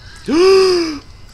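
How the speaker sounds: loud theatrical gasp; high dramatic gasp. Acted. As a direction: gasp as if struck to the heart. Mock shock.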